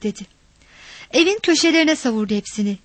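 usual speaking voice

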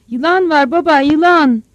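loud, high, frightened shout; panicked stammering cry. Acted. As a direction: raise the alarm in panic. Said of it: "diye kekeledi". (korkuyla, telaşla haykırarak)

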